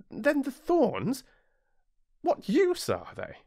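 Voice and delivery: put-on high boyish voice, insistent; a small prince presses the pilot with a sudden question; little prince demanding. Narrator voices the little prince, high and insistent.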